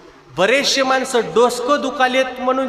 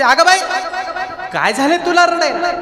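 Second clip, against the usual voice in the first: high, gentle voice comforting a weeping animal; tender concern; 9 semitones higher than usual. Acted. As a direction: soothe her like a frightened child. Tukaram comforting the buffalo. (रडणाऱ्या म्हशीला प्रेमाने समजावणे)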